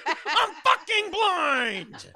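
high, hammed-up parental meltdown; exasperated yelling. Playing a parent fed up with hearing mommy, exasperated yelling. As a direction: yell it high as a comic parent at wit's end. Mock outburst at pestering child.

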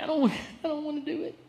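high, fast whining refusal; whiny reluctance. Retold or imitated. Preacher mimics someone whining about God's call, high-pitched fast whine. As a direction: whine it like a sulky kid. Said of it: "and you're like".

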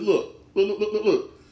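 high, quick repeated "look"; mock excited patient; excited urging. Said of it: "he said".

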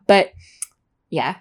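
ordinary talk

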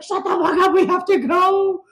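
high, loud mock yell; frantic yell. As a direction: yell at them to get moving. Azriel yelling to leave.

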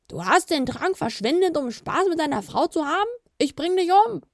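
high, loud, outraged yelling; furious outburst. A narrator's reading. Elf learning Nip wasted the potion, furious. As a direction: build from outrage to a high shouted threat.

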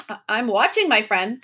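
usual speaking voice

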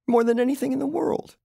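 high, overwrought declaration of love; choked up. A narrator's reading. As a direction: push it out high through the emotion. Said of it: "She choked up".

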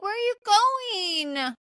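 put-on high, slow, whining cry after the fleeing girls; Nancy calling after friends, mock-pleading; mock whiny call. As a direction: wail it high and drawn out. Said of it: "Nancy's like".

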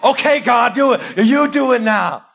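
acted-out giving in, high and loud; hammed-up exasperated plea, pitched high; exasperated surrender. Acts out finally giving in to God.

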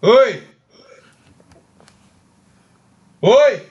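grito alto e agudo; chamando alto. Real, not acted. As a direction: chame alguém em outro cômodo. Chamando alguém de outro cômodo. (grito de chamada)

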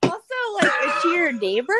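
loud, high, disbelieving question; incredulous. Real, not acted. Friend shouts in disbelief at the neighbor story, incredulous. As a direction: blurt it out in disbelief.

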